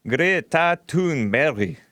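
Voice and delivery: Greta Thunberg slowly copied in Swedish; imitated Swedish pronunciation. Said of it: "Say her name in Swedish again".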